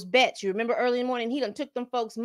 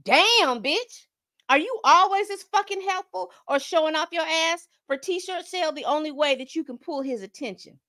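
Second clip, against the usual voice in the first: quoting Lisa's insult; 7 semitones higher than usual. Retold or imitated. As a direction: fling the insult sharp and high. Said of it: "and I quote".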